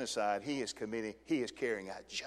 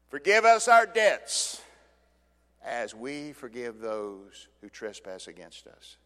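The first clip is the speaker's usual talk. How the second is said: slow prayerful plea; 7 dB louder, slower than usual. Acted. Preacher reciting the prayer to the congregation, slow and lifted. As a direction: lift the prayer out slowly.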